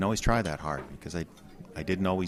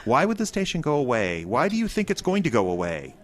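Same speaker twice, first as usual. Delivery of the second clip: alarmed; 5 semitones higher, 4 dB louder than usual. Acted. Alarmed questioning.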